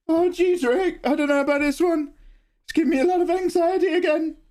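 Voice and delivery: put-on worried nervous kid, voice way up; Morty. Streamer slipping into a cheap Morty impression. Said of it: "That was Walmart Morty".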